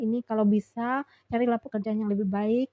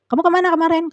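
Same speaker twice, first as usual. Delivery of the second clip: high, loud mock interrogation; imitated scolding; 7 semitones higher, 5 dB louder than usual. Leader scolds worker at briefing over yesterday's absence, imitated scolding. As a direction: act out a boss demanding an explanation. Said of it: "aku diomel-omelin".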